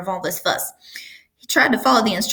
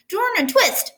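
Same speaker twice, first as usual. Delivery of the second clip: much higher goose honk; 13 semitones higher than usual. A narrator's reading. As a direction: honk the order out. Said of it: "honked the goose".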